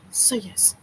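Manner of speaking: whispered "so yes"; sly whispered aside. Real, not acted. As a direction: breathe it as a secret aside.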